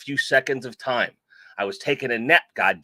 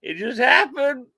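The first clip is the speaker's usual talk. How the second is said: high-pitched mock protest; high joking excuse; 11 semitones higher, 4 dB louder than usual. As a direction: say it high, playfully defensive.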